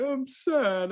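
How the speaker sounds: high-pitched mock sob of a child; imitated crying toddler. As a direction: mimic a small child wailing it. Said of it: "he was in my arms crying".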